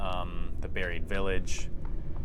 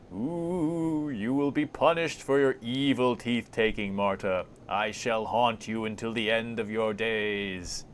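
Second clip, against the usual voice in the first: slow, wailing haunting voice; ghostly threat; 3 semitones higher, slower than usual. Acted. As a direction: stretch the words out like a vengeful spook.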